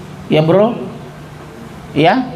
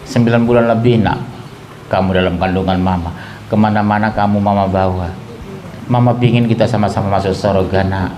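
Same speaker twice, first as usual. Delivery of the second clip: gentle mother; 9 semitones lower than usual. Shown as a demonstration. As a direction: coax your child tenderly. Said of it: "Lembut aja, Bu".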